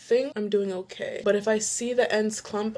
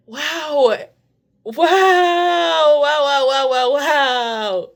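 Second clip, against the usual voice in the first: louder, higher stream of wows; awed; 5 semitones higher, 8 dB louder, much slower than usual. Real, not acted. Staring at her defined curls, awed wows. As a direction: lose your words in amazement.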